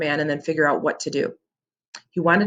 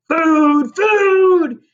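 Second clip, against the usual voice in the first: loud, much higher roaring yell; wild scream; 12 semitones higher, 8 dB louder than usual. Acted. Cyclops runs wild holding the boys, screaming. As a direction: scream it like a mad giant. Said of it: "roaring wildly and screaming".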